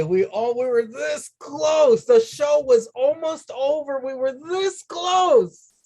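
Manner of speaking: anguished lament. Real, not acted. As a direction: moan at how close it was. Laments the show nearly ending.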